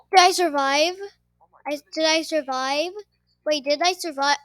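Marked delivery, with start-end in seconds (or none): none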